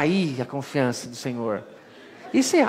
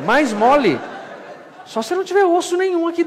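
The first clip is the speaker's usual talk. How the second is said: protesto agudo; 13 semitones higher, 5 dB louder than usual. Retold or imitated. Responde ao instrutor com pavor.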